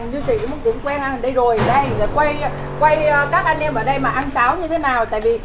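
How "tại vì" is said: ordinary talk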